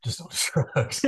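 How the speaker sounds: high mocking echo of the warning. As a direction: parrot the warning back teasingly. Echoing the warning, mock voice.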